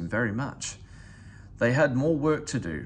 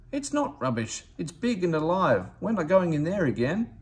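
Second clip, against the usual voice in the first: frightened insistence; 6 semitones higher than usual. Acted. Scared workmen insist.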